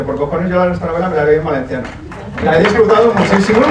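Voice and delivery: usual speaking voice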